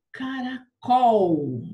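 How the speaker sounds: "Caracol" very slow, with a loud, stretched COL; stress on COL. Shown as a demonstration. Teacher sounding out caracol for pupils, stressed on COL. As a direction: draw out "Caracol", leaning hard on the final COL. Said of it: "Tem acento no col".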